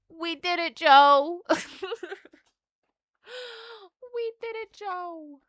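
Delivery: high, giddy celebration; excited cheer. Real, not acted. Celebrating a card pull, giddy high cheer. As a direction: cheer like you won.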